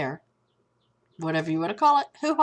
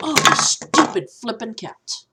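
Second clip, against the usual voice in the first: loud irritated outburst; real exasperation; 3 semitones higher, 6 dB louder than usual. Real, not acted. Vlogger interrupted by the cat, real exasperation. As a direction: snap at a pet that interrupts you.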